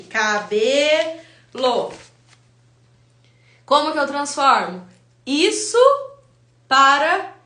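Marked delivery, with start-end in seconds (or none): 0.0-1.9 "cabelo" drawn out very slowly, syllable by syllable; dictating slowly. Shown as a demonstration. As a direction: dictate "cabelo" as if writing it on the board. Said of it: "Vou escrever aqui". (ditando bem devagar)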